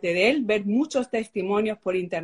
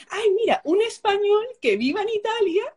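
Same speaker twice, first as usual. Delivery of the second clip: high, bright-eyed sudden idea; girl excitedly revising her list for a husband; eager idea; 11 semitones higher, 4 dB louder than usual. Retold or imitated. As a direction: leap up in pitch as the idea hits. Retelling her eager wish list.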